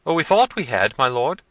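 high, loud frightened reply; terrified servant. A narrator's reading. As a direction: answer your master in a high, alarmed voice. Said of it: "looking terrified".

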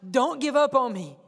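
preacher voicing an urgent appeal; crying out for help. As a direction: call out as someone begging for help. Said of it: "they're crying out".

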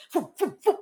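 high, quick puffed whooshes; imitated whooshes. Everybody scattering at the gunshot cry, high imitated whooshes. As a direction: puff out sounds of people shooting off.

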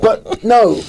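amused, laughing dismissal. Real, not acted. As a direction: talk through the tail of a laughing fit. Still laughing at song references.

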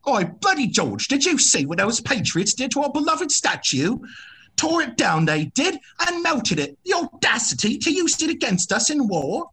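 high, hammed-up British accent; mock British accent. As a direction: play a fussy British loyalist in a put-on British accent. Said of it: "that's British [name]".

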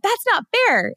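high-pitched indignant complaint; mock mad. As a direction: imitate someone protesting in a huff. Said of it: "some people were mad".

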